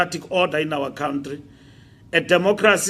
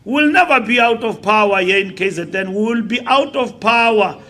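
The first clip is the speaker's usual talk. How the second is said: loud, raised political oratory building to a shout; impassioned rally speech; 6 semitones higher, 10 dB louder than usual. Real, not acted. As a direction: drive it home to the crowd, getting louder and higher.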